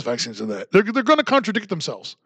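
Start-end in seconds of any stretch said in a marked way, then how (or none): 0.0-0.7 much lower, softer mock; mocking low take. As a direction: answer yourself down low. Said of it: "the contradictions from the left".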